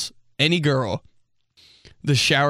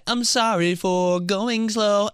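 mock apology; 9 semitones higher, slower than usual. Mocking slow left-lane driver.

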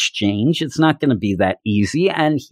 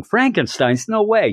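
mild mock yell; 9 semitones higher than usual. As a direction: imitate a delighted yell without the volume. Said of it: "She's yelling".

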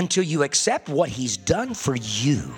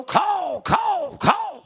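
shrill crow screech climbing much higher; hollered caw; 4 dB louder, much slower than usual. Retold or imitated. Crows hollering at the tied crow to fly south, screeching caws. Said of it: "Here comes the crows over hollering".

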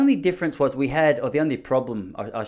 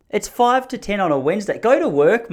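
high, raised-voice grumble; exasperated complaint; 9 semitones higher, 6 dB louder than usual. Real, not acted. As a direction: tell off the noisy neighbour, half-joking. Grumbling about noisy neighbour at home.

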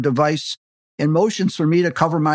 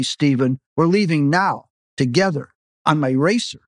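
ordinary delivery, nothing marked

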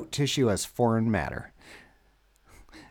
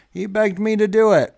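doctor's voice; 8 semitones higher, 4 dB louder than usual. Retold or imitated. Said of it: "the doctor's like".

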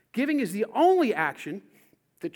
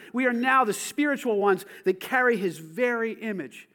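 1 preaching fervor; 5 dB louder than usual. Real, not acted. Preaching at full force.

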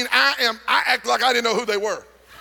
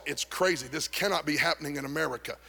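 stunned inner thought; 5 semitones lower, 8 dB quieter than usual. Retold or imitated. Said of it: "And I thought when I saw it".

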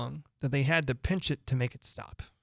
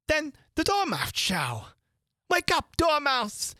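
high, loud and slow call; crying out; 14 semitones higher, 3 dB louder than usual. A narrator's reading. Hare and Hatter crying out to wake the Dormouse, loud. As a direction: call the sleeper awake. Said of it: "they both cried".

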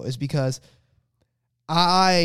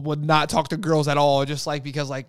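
ordinary talk

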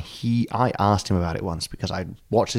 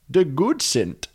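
put-on silly Dutch accent, pitched up; comic higher-voiced Dutch rendering; mock Dutch; 7 semitones higher, much slower than usual. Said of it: "or in Dutch".

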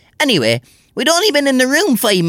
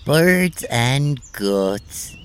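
dazed groggy; 7 semitones lower, 4 dB quieter, much slower than usual. Acted. Knocked out, fading.

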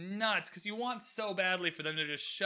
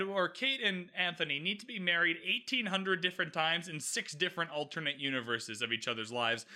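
ordinary talk, nothing marked

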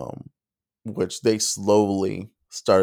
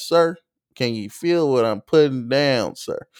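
Southern twang; 3 semitones higher than usual. Retold or imitated. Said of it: "being more comical".

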